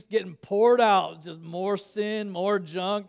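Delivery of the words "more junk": ordinary talk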